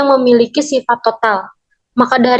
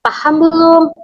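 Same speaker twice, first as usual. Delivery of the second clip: "Paham belum?" asked on a high pitch; question intonation; 5 semitones higher than usual. Shown as a demonstration. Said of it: "intonasi yang bertanya sehingga nada akhirnya naik". (intonasi bertanya bernada tinggi pada "belum")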